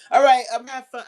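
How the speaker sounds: cheerful sign-off from the bit; loud amused wrap-up; burst of amusement. Real, not acted. Breaks character, laughing off.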